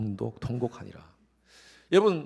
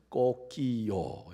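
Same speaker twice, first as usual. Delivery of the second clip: slow low crow; 4 semitones lower, much slower than usual. Retold or imitated. Same crow, Korean and Thai.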